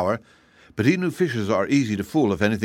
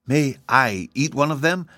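higher, pleading kitten voice; 5 semitones higher than usual. A narrator's reading. Kitten asking to eat a piglet, pleading. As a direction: beg sweetly for a morsel. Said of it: "in a pleading voice".

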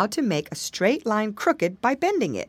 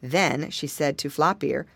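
ordinary delivery

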